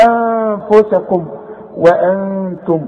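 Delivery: slow "أنفسكم وأنتم", n held as a hum; hidden nasalised noon. Shown as a demonstration. Teacher recites verse for pupil, nasal hidden noon. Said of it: "اخفي النون".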